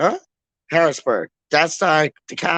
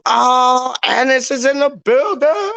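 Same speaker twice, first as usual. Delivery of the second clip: hyped announcement; 9 semitones higher, 3 dB louder than usual. Real, not acted. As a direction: announce an arrival with a soaring, stretched finish. Hyped greeting of an arriving viewer.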